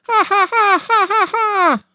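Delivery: very high, far-off cackling ha ha; screaming creature laugh. A narrator's reading. A Manitou screaming out by the traps, very high cackle. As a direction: shriek out a spirit's laugh, way up high. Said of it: "creature screaming out".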